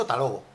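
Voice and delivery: soft, low "hasta luego" shrunk to "talogo"; everyday "hasta luego", no "has", no diphthong; reduced form. Shown as a demonstration. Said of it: "Yo he dicho".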